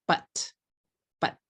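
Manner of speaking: "but" said crisply as one short syllable; careful demo. Shown as a demonstration. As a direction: model "but" as one clean beat.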